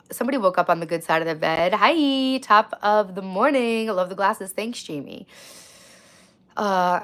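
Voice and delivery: ordinary talk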